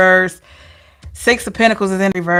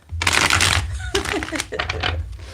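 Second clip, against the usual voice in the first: long breathy laugh; airy laugh; 11 semitones higher, 5 dB quieter than usual. Real, not acted. Reader laughs at her owl obsession, airy laugh. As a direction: laugh quietly through it.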